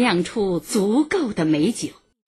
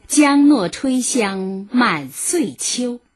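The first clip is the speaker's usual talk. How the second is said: slow poem quote; 5 semitones higher, 4 dB louder, slower than usual. Shown as a demonstration.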